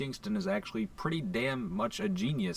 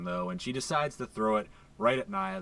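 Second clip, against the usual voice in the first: usual speaking voice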